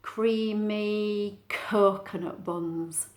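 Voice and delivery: slow, stretched "creamy coconut buns"; "creamy coconut buns" said slowly, word by word; alliteration demo. Shown as a demonstration. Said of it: "write an adjective to describe it".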